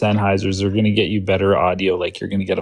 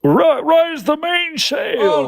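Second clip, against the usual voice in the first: sailor call; much slower than usual. Pretend. Sailor calling out on boat.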